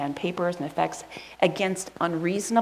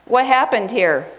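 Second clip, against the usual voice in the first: louder, higher mock parent; parent demanding answers; 4 semitones higher, 9 dB louder than usual. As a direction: bark it like a suspicious parent. Parent questioning kids at home.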